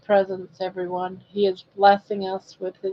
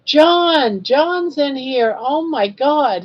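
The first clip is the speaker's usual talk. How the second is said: high, excited exclamation; real excitement, pitched well up; excited surprise; 6 semitones higher, 5 dB louder, slower than usual. Real, not acted. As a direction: announce a rare guest with raised pitch. Delighted that rare viewer joins.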